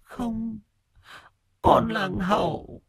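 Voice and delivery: very high, soft and slow ghost voice; a cold drowned child calling for mother; ghostly son's voice. Acted. Drowned son's ghost calling to his mother, high and slow.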